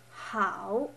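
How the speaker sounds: "how" dipping then rising; third tone. Shown as a demonstration. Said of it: "The third one".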